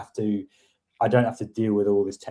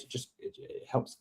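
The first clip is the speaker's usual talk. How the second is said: muttered aside; 14 dB quieter than usual. Real, not acted. Drops to near silence.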